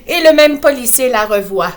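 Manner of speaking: usual speaking voice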